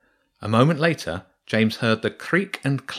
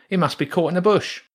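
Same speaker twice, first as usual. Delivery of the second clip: much higher rat voice straining; rat calling for help; 9 semitones higher, 3 dB louder, faster than usual. Acted. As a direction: call your mate over to pull. Rat pulling the cable.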